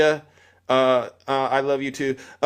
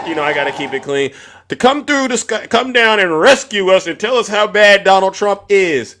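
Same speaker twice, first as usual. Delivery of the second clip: ordinary talk, nothing marked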